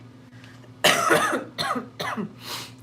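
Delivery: real cough, breathy; a rough hacking cough; chesty cough. Real, not acted. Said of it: "I have a cough".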